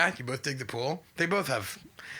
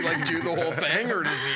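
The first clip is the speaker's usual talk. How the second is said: normal talking voice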